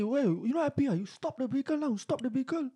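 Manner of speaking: high challenging taunt; mock challenge. Rider daring the driver to stop, high taunt. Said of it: "I say".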